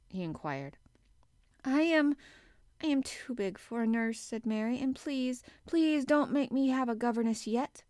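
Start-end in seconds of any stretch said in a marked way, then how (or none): 1.7-4.8 put-on high-pitched little girl's plea; nervous girl begging; pleading child. A narrator's reading. Child pleads with her guardian. 4.8-7.8 put-on high-pitched little girl's plea; nervous girl begging; pleading child. A narrator's reading. Child pleads with her guardian.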